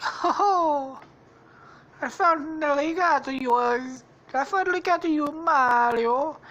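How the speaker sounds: high, gloating cartoon villain; Squishy. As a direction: crow in triumph, high and put-on. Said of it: "Squishy's like".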